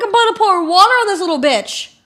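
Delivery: loud, high-pitched fed-up rant; exasperated outburst. Retold or imitated. Passenger losing it at kid hitting his mom, exasperated. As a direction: vent it loudly, at breaking point.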